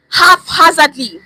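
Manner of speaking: "haphazardly" slow and loud, falling; explaining "haphazardly" slowly and firmly; stress haphazardly. Shown as a demonstration. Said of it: "I know I'm speaking English".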